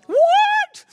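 a sudden high, loud, stunned cry; shocked what. Retold or imitated. Told the deal is off, a high, loud, shocked cry. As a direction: react like the floor just dropped out.

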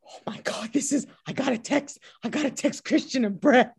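mock fan panic in an excited whisper; breathless, high whisper rising into a squeal; starstruck gasping. Imagined starstruck reaction to meeting Scorsese.

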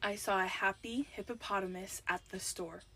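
low-key, quiet delivery to a headphone wearer; soft first take. Real, not acted. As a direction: say the phrase softly and evenly. First, softer take of game phrase.

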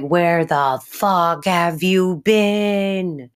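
slow, drawn-out mock outrage; angry confrontation. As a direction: stretch every word like an exasperated partner. Dex confronting Anna on return.

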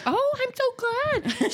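high, slow, drawn-out delight; gushing parent. Retold or imitated. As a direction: coo with exaggerated gladness at a child. Parent gushes back at excited kids.

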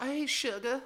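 put-on high, sugary come-on as a Western saloon girl. Saloon hooker calling to a customer, high sugary voice. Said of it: "they're like".

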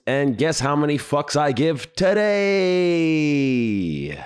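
slow, smug, savoring the question; cheeky drawn-out tease. Acted. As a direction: stretch it out slow and teasing, like a setup for a joke. Host's cheeky opening punchline.

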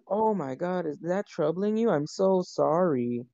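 sarcastic. Retold or imitated. Imitating cable service guys taunting complaining customers, sarcastic. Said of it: "being real sarcastic about it".

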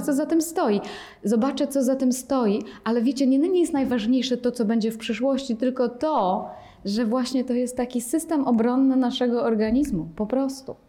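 ordinary delivery

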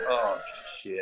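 low, soft sinking aside; retold dismay. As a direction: mutter it as if dread just landed. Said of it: "and I'm like".

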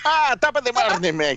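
high, mock Irish leprechaun voice; leprechaun accent. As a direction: lilt it like a leprechaun. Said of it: "accents I try to do just sound like a leprechaun".

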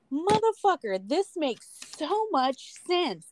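high, slow and amazed; stunned realization. Retold or imitated. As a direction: mind blown, drawn out high. Quoting her own mind-blown reaction.

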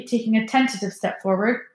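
ordinary delivery, nothing marked

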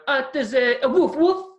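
high, cheerful mock Italian chef; high chef cheerfully naming the meat. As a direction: proudly announce it like the chef. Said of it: "And he went".

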